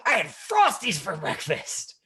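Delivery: line said high, loud and slow with glee; excited line reading. Retold or imitated. Imitating a student now delivering the line with excitement. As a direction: carry the thrill into the line. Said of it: "Say the line like you said that".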